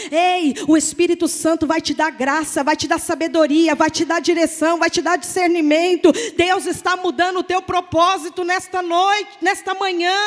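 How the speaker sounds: loud, driving declaration; fervent preaching. Acted. Pastor loudly proclaiming gifts of the Spirit to listeners.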